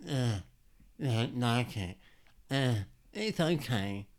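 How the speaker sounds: loud, halting, reluctant grumble; exaggerated hesitant voice of an unsatisfied designer; mocking impersonation. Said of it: "I don't talk like that".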